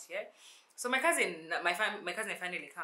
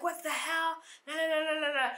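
alarmed shouting; 10 semitones higher, 4 dB louder than usual. Retold or imitated. Both shouting about the snake.

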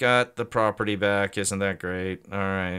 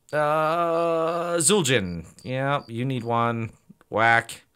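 usual speaking voice

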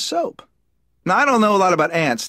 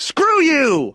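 high, drawn-out cry of defiance; defiant outburst; 8 semitones higher than usual. Acted. Ant walking out of the hole, defiant outburst. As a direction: throw it back as a rebel. Rebel ants quitting the hole.

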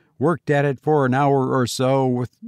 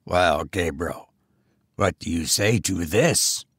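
goblin voice; 7 semitones lower than usual. A narrator's reading.

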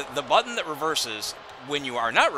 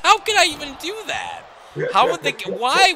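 shocked disbelief; 12 semitones higher, 8 dB louder than usual. Real, not acted. Shocked at his own game move.

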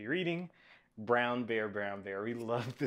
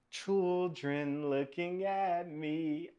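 slow, higher, lilting answer line; sing-song story reading; 6 semitones higher, much slower than usual. Acted. Reader finishing the book's refrain for children, slow sing-song. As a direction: stretch it out musically for little listeners.

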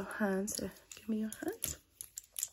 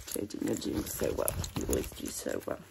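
deep voice. Acted. Pitched down praise.

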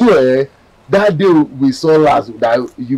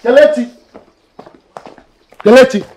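high, loud shouted name; shouting; 11 semitones higher, 5 dB louder than usual. Acted. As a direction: call out the name at full voice. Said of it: "Why are you shouting my name?".

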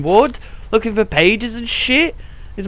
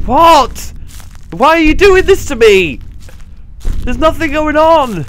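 frightened pleading; 8 semitones higher, 5 dB louder, slower than usual. Real, not acted. Frightened player pleading with game.

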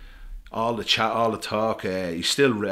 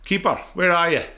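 put-on a higher, louder Scottish accent calling out to the keeper; Scottish coach; 9 semitones higher, 6 dB louder than usual. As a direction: call across the room in a put-on Scottish accent. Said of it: "it's a really poxy Scottish accent I'm gonna do".

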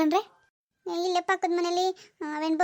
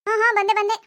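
calling back; 7 semitones higher than usual. Acted. Answering a call from afar.

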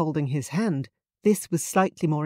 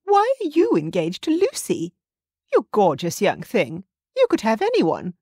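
higher, fawning mother flirting; Justine's high voice; 5 semitones higher, 4 dB louder than usual. A narrator's reading. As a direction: coo over the handsome fiancé, pitched up.

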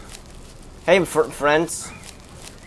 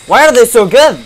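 loud, higher exasperated yell; frustrated shout; 7 semitones higher, 13 dB louder than usual. Real, not acted. Player losing to skilled NPCs, loud frustrated shout. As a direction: bellow your frustration at the game. Getting beaten by game NPCs.

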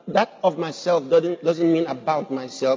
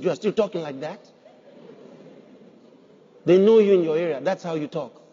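ordinary delivery

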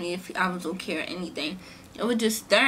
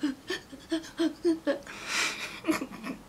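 teary laugh; 7 semitones higher, 6 dB quieter than usual. Retold or imitated. Laughing-crying after saying it.

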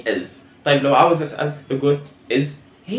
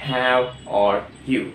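How are you drawn out very slowly; teacher modelling the question word by word; slow, clear classroom question; slow model question; much slower than usual. Shown as a demonstration. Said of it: "فبقول".

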